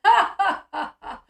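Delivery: loud imitated cackling ha's; mock laughing. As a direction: act out her laughter, loud and forced. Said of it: "laughing about it".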